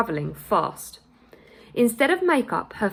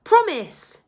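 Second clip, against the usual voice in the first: high, loud desperate plea; pleading; 11 semitones higher, 5 dB louder than usual. A narrator's reading. Mother begging her son to promise to stay. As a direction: beg him to promise. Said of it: "she pleaded".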